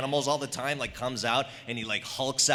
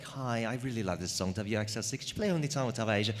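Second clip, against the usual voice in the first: softer, quicker disguised caller; different voice; 5 dB quieter than usual. Retold or imitated. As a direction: sneak in as yet another caller. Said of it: "use a different voice every time".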